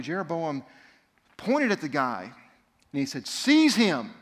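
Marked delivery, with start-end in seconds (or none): 3.3-4.1 loud, high royal order; angry king ordering an arrest; commanding king. Acted. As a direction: bark the order at the guards.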